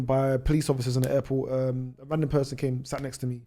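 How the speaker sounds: ordinary talk, nothing marked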